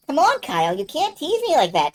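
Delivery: high-pitched, wheedling plea; coaxing protest. Acted. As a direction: press a teasing partner, voice swooping up. Jess coaxes Kyle to confess.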